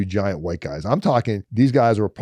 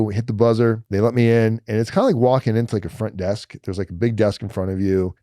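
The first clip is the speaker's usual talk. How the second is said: normal talking voice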